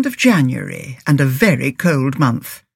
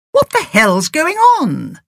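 high, sharp outraged question; indignant demand; 12 semitones higher than usual. A narrator's reading. Stuck driver confronting a youth, indignant and sharp. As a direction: demand answers from a stranger. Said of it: "demanded Agatha".

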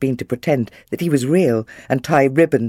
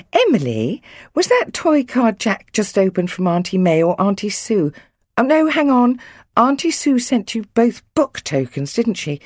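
higher, mildly flustered mum checking who gave what; a touch of anxious fretting, pitched up; 7 semitones higher than usual. A narrator's reading. Mum checking the present list with Emily, mildly anxious. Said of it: "She stared at the list anxiously".

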